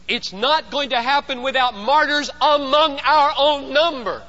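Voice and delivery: high, emphatic sermon delivery building in volume; impassioned preaching. Real, not acted. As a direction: preach it with rising force, hitting the key words. Preaches at full force.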